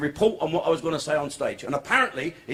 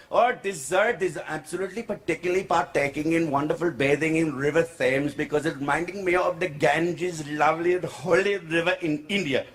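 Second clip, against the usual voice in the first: put-on chattering comic Indian English accent. Uncle explains bathing to policeman, Indian accent. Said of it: "I won't do the accent".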